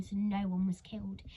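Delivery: ordinary delivery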